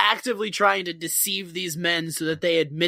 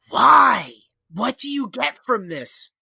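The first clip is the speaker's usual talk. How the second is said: 1 incredulous why; 4 semitones higher, 5 dB louder, slower than usual. Real, not acted. Asking incredulously.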